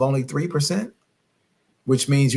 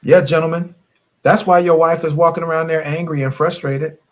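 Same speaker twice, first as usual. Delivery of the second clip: preaching at couples; 3 semitones higher than usual. Acted. Coach scolding married couples on her live broadcast, preaching hard. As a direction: call out the married folks like a sermon.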